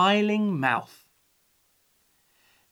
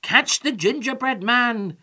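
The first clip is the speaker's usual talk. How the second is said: raised, urgent call; shouting; 7 semitones higher, 4 dB louder than usual. A narrator's reading. Old man to the chickens, shouting. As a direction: call out the order high. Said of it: "shouted the old man to the chickens".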